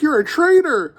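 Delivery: high, loud mock accusation; mock accusing shout. Reviewer imitates Kwan accusing someone, high mock shout. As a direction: cry it out as a hammed-up denunciation.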